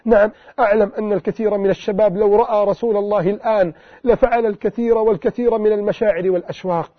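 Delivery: ordinary talk, nothing marked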